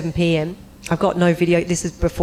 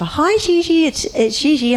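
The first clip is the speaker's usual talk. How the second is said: high, sing-song phone greeting; chirpy assistant; 10 semitones higher, 3 dB louder than usual. Retold or imitated. Assistant calling her on the phone, chirpy and high. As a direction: play the bubbly assistant on the phone. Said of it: "And she's like".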